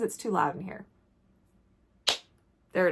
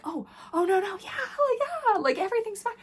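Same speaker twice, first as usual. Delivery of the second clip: high, flustered reassurance, masking panic; high nervous "everything's fine" to a stranger; flustered fake cheer; 12 semitones higher, faster than usual. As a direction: squeak out that all is fine. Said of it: "and then I'm like".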